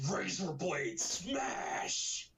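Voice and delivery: slow, strained roar; Hulk. Acted. Reviewer having a go at smashing Razorblade, slow strained roar. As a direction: drag out an angry monster yell. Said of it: "trying to do a Hulk impression or be angry".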